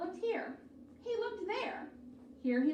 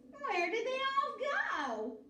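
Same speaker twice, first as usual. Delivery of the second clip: shouted; 9 semitones higher than usual. A narrator's reading. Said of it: "Gretch shouted to a duck".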